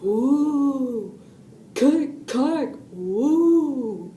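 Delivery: eerie drawn-out woo and clicks; spooky noise. A narrator's reading. Noise outside a mouse's cage at bedtime, spooky imitation. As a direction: imitate a scary robot noise in the night. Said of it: "he heard a noise".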